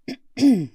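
a loud, awkward throat clear. Acted. Therapist breaking awkward silence, loud throat clear. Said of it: "reset his vocalizer".

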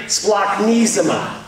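Splachnisma said very slowly as splak-NEE-zma; slow Greek demo. Shown as a demonstration. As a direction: lead the room through Splachnisma, dragging each syllable out. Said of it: "We're all gonna try this".

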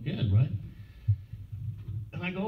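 ordinary talk, nothing marked

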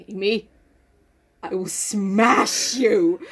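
slow, drawn-out threat; hammed-up warning; mock menace. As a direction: threaten with comic deliberateness.

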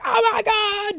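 put-on spotting an old friend across the crowd; surprised squeal.

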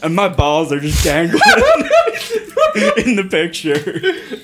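words broken by laughter; cracking up mid-sentence; laughing. Real, not acted. Laughing through own story.

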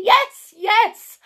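very high, loud eager cries; excited. A narrator's reading. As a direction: agree with bursting enthusiasm. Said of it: "said Raj excitedly".

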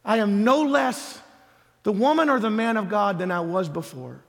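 usual speaking voice

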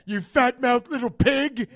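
loud, high, drawn-out mock fury; angry chef impression at full volume; screamed insult. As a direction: yell it like a raging TV chef. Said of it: "He screams at people".

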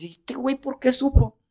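voz mucho más aguda, de asombro incrédulo; incrédulo burlón. Retold or imitated. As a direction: imitando a alguien que no se lo cree. Imita al público incrédulo. (el público, pasmado de que él supiera, pregunta muy agudo)

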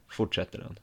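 usual speaking voice

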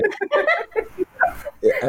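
high bursting laugh; big laugh. Real, not acted. Laughs at the family gossip joke. (risada alta)

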